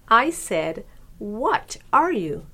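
slow, rude repeat with "What" stressed. A narrator's reading. Other turtle insisting on its question to Myrtle, rude voice. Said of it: "in a rude voice".

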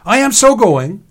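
high, loud protest; defiant child. A narrator's reading. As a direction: shout back like a defiant little brother. Sam shouts back at Leon.

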